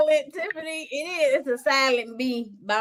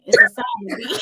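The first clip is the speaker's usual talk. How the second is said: high squeal while laughing; laughing shout; 10 semitones higher, 5 dB louder than usual. Real, not acted. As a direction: laugh it out loudly. Laughing at her own word.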